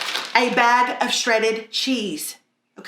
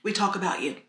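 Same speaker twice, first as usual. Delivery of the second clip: hushed; 5 semitones lower, 8 dB quieter than usual. Acted. Confessing gossip.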